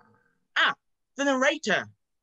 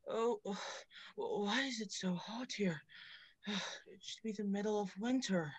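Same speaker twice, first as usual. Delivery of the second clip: sighing in the heat; 2 semitones lower, 13 dB quieter than usual. Acted. Sweltering in the hot cave.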